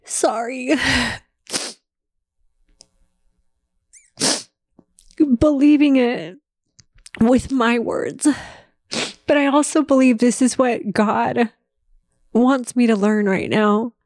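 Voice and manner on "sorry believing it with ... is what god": crying. Real, not acted.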